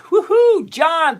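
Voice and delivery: high, loud whoop and name call; excited whoop. Real, not acted. Host cheering John in the live chat, excited whoop.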